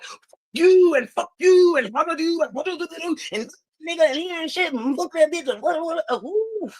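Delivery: high, fast, hammed-up ranting; mock cursing rant. Mocking people who curse everyone out, high fast rant. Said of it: "out here like".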